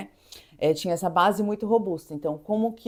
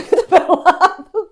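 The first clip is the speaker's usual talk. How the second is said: laughing; 9 semitones higher, 10 dB louder than usual. Real, not acted. Laughs while retelling the yelling.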